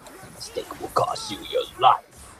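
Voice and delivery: slow, menacing game-villain voice; voice-actor villain. Pretend. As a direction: play a hammed-up villain, drawing out each threat. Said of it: "I also wanna be a voice actor".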